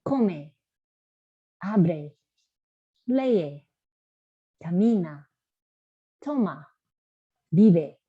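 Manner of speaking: teacher drilling, slow and clear; teacher leads the class in repeating. Shown as a demonstration. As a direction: model each word with pauses for students to repeat. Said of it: "Repitan ustedes".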